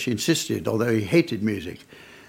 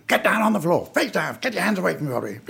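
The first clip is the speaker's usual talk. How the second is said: higher, fast mock robber yell; robber shouting; 7 semitones higher, faster than usual. Retelling armed robbers ordering staff down, mock shouted orders. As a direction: bark orders like a hold-up man, hammed-up. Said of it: "they came in shouting".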